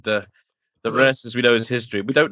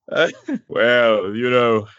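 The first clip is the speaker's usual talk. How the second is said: fourth Doctor impression; 4 dB louder, slower than usual. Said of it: "the fourth Doctor".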